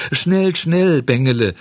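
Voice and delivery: a goat on a rock calling to the swimming puppet; bleating goat call. A narrator's reading. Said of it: "meckerte die Ziege".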